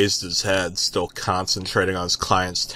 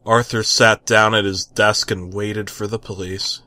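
plain reading voice

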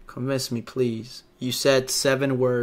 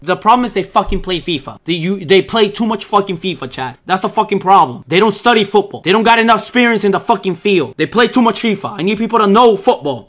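angry rant; 6 semitones higher, 6 dB louder than usual. Real, not acted. Manager raging to Chad about players who only play fifa, loud and furious. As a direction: vent at them, building fury.

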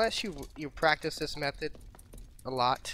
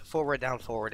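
forward, down, forward in one unbroken run; run-together wrong example. Shown as a demonstration. Player showing viewers the unwanted inputs, run together. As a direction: say the three inputs straight through without pauses. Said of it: "that's not what we want".

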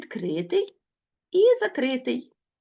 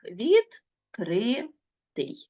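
Відкритий drawn out, each syllable set apart; much slower than usual. Shown as a demonstration. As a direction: split Відкритий slowly into syllables. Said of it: "на склади можна ділити ще і ось так". (Відкритий повільно, по складах)

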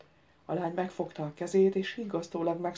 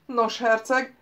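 raised, louder address from an unseen voice; megszólító hang; 6 semitones higher, 7 dB louder, slower than usual. A narrator's reading. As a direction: hail the prince, pitched up and loud. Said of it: "szólt a hang". (megemelt hangú, erős megszólítás)

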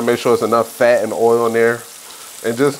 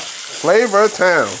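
drawn-out flavor; 5 semitones higher, much slower than usual. Acted. Announcing flavor with relish.

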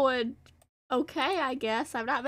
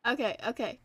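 ordinary delivery

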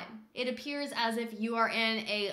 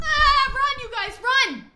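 shriek, then high yelled warning; screaming in fright; 12 dB louder, slower than usual. Real, not acted. Player urging friends away from the rat, panicked screaming. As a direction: scream for everyone to flee.

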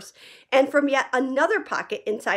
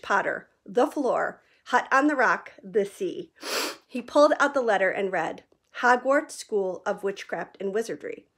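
usual speaking voice

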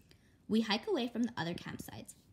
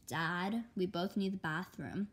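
usual speaking voice

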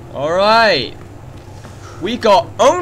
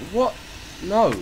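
ordinary delivery

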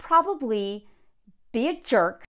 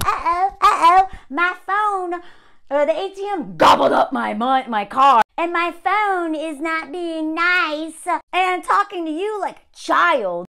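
mocking helpless old man; 5 semitones higher, 6 dB louder than usual. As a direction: ham up a helpless old man who can't work his phone. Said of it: "was like".

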